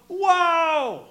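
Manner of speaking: high, loud cry of wonder; amazed whoa. Acted. Congregation reacting to Jesus, loud amazed whoa. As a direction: gasp it out in amazement. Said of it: "the congregation goes".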